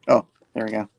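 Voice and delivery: usual speaking voice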